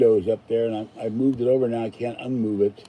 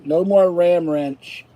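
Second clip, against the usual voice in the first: slow emphatic; 4 semitones higher, 4 dB louder, much slower than usual. Real, not acted. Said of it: "I'm tired of the Ram Wrench".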